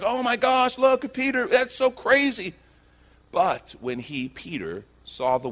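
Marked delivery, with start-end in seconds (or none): 0.0-2.5 high-pitched awe, drawn out at the end; amazed disciples. Acted. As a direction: marvel in a high, stretched voice. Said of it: "Amazing moment. The other disciples are like".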